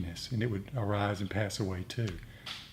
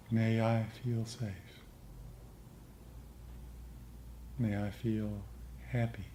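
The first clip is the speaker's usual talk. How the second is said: guided meditation; 5 dB quieter than usual. Acted. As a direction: lead the group gently into metta. S0 leads metta meditation.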